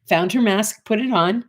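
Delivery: ordinary delivery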